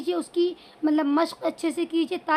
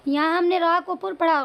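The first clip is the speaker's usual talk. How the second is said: normal talking voice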